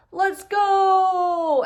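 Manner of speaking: loud, high rallying shout; giant's shout. Acted. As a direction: call it out like a giant setting off across the ocean. Giant sets off carrying Ivan.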